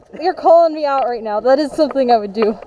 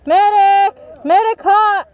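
man down shout; 6 semitones higher, 3 dB louder, much slower than usual. Real, not acted. Hit, calling for a medic.

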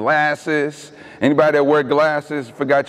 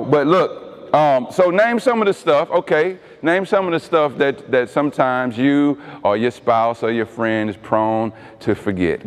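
ordinary talk, nothing marked

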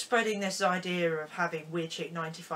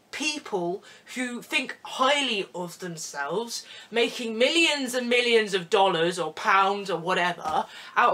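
loud, raised-pitch mock rant; angry rant; 5 dB louder than usual. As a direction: rant indignantly about it. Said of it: "maybe I can make this into a rant".

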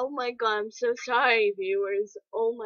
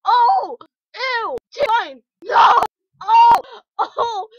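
high, loud yells of disgust; disgusted screaming; 8 semitones higher, 11 dB louder, slower than usual. Real, not acted. Warning viewers not to look, high disgusted screaming. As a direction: scream it like you can't unsee it.

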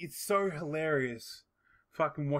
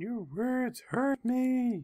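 high, drawn-out mock wail; mock hurt; 7 semitones higher, 3 dB louder, much slower than usual. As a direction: slowly whine it in exaggerated hurt. Mocking oversensitive internet users.

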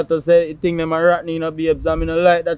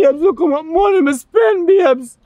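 high, strained cry of worry about the money; distressed wail; 11 semitones higher than usual. Acted. Farmer wails to his partner about the money spent, high and distressed. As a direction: wail it out, voice pitched up in panic. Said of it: "What make you can't calm down".

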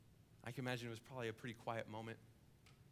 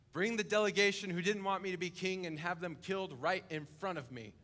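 higher, louder ruthless order; cruel command; 4 semitones higher, 8 dB louder than usual. Acted. King ordering his enemies killed, loud harsh command. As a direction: bark the execution order.

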